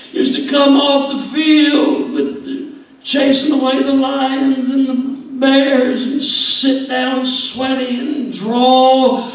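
put-on high, loud, half-sung longing for the old days; nostalgic David. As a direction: play David aching for home, voice lifted and sung. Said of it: "pouring out in that cave his raw nostalgia".

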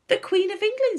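very high and loud; high, loud, cross comeback; indignant retort. A narrator's reading. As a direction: throw it back triumphantly, pitched right up. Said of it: "getting angrier and angrier".

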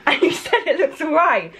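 loud, high protest broken by laughter; laughing scolding. Real, not acted. Girlfriend scolding boyfriend after the prank, laughing loudly. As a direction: tell him off while cracking up.

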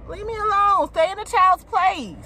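ordinary talk, nothing marked

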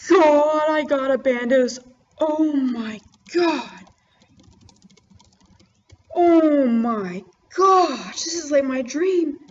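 loud, high, tearful cries of joy; screaming with real joy, voice cracking; overjoyed shout. Real, not acted. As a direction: yell it out, can't believe the drop. Huge drop after many kills.

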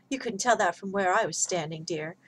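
normal talking voice

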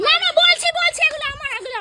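high, loud blurting; frantic confession. Acted. Admitting the parcel is hers under threat, frantic and loud. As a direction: blurt it out to stop him.

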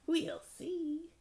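very high witchy squeal; witch voice. A narrator's reading. Witch toying with the frog, high witch voice. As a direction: play a high-pitched, scheming witch.

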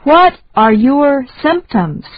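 ordinary talk

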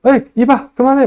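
high, loud mock scolding to stop someone; teacher acting out telling a friend to stop; restraining someone. Shown as a demonstration. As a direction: cut them off, tell them to quit it. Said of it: "남을 제지할 때도".

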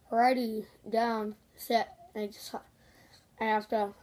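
talking around mouthpiece; muffled through mouth guard. Real, not acted. Seen 0: Mouth guard visibly in mouth 0.2–3.7s; removed by 4.5.

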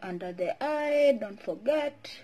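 speaking through fingers; muffled by own hand; hand over mouth. Real, not acted. Seen 0: Fingers spread mask cream across mouth and nose while speaking.

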